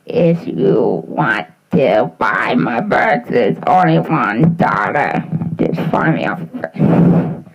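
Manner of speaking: deep, slow filtered sales pitch; deep filtered voice ending in a whisper; dialogue voice. Pretend. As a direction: pitch the books in a deep put-on voice. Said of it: "when I'm talking like that".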